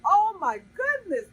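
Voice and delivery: high, thrilled oh my goodness; gasping with joy at the produce; excited gasp. Real, not acted. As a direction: gush with real delight. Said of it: "How excited is that?".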